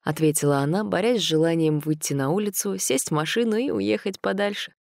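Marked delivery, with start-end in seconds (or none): none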